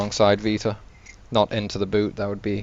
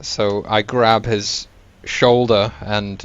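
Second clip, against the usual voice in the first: ordinary talk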